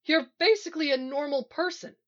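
high-pitched appalled realisation; horrified. A narrator's reading. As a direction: say it as a horrifying discovery. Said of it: "sounding horrified".

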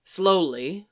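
plain reading voice

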